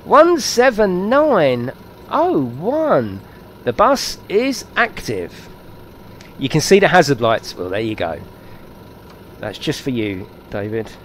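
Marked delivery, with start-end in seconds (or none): 0.0-5.5 high, slow, drawn-out singsong call; hammed-up swooping announcer voice; mock announcement. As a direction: announce it grandly, stretching each word. Hammed-up bus number announcement.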